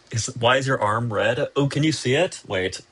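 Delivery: ordinary talk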